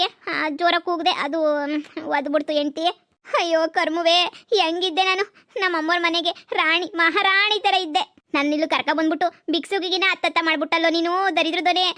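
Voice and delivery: ordinary delivery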